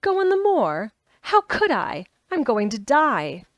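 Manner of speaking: high, loud startled cry of disbelief; jolted upright, voice leaping up and loud; startled outburst. A narrator's reading. Sick boy startled by the girl's bold idea, loud high alarm. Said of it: "He moved as if he were startled".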